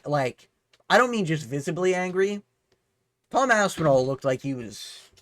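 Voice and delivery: usual speaking voice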